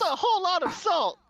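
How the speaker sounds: high, loud, hammed-up yelp; hammed-up cartoon outburst; mock shocked shout. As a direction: blurt it out like the cartoon character. Quoting clip line mid-laugh.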